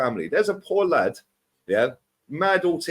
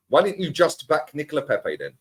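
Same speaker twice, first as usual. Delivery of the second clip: ordinary delivery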